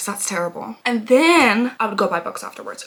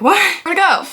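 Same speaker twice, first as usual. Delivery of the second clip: surprised; 8 semitones higher, 7 dB louder than usual. Retold or imitated. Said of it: "I was like".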